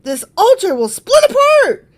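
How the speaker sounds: loud, high proclamation; prophetic shout. Acted. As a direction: declaim it at full voice like a prophet. Reader voices the shouting prophet.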